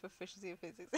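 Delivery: ordinary talk, nothing marked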